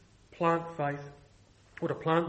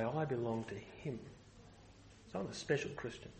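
mock smug; 6 semitones lower, 13 dB quieter than usual. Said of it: "put them up on a pedestal and say".

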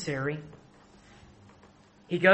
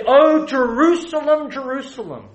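high, loud anguished cry fading on the second "Jerusalem"; passionate lament; 9 semitones higher, 10 dB louder than usual. Acted. Jesus lamenting over Israel, a loud anguished cry. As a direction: cry it out in grief, then let it sink. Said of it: "great passion and emotion".

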